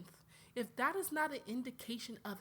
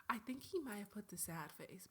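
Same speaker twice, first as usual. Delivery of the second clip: breathy whispered aside; 6 dB quieter than usual. Real, not acted. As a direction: whisper it as if reading the screen. Whispered aside while checking phone.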